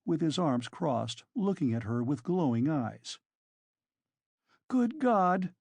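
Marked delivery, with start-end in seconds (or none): 4.7-5.5 high-pitched anguished exclamation; sudden cry of dismay; distressed outburst. A narrator's reading. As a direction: let it burst out in alarm. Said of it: "broke from Sonia".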